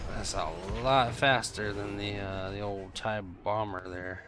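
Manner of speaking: ordinary talk, nothing marked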